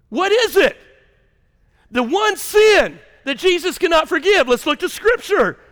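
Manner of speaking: loud, high challenging sermon; preaching at full force. Acted. Pastor challenging his listeners about their past, loud and high. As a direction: press the hard questions on them.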